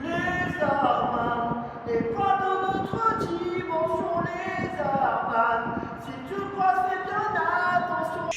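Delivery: very high, slow eerie prophet voice; a high wailing oracle warning of danger; mystic prophet voice. Acted. Spooky warning voice.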